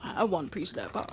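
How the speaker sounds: low, fast, quiet monster voice; a soft, low, hungry creature mumble; greedy monster. Retold or imitated. Aside to the listening kids, low quick monster voice. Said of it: "He has his tongue out like".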